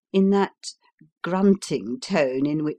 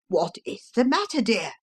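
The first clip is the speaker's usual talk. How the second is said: worried father; 6 semitones higher than usual. Acted. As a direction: ask the child gently but anxiously. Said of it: "inquired the father once more".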